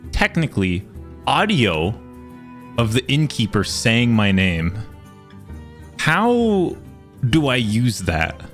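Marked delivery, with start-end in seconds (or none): none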